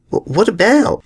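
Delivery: put-on high, young filly asking, a little startled. Young Apple Bloom to her friend, startled and high. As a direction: pitch up into a startled young girl.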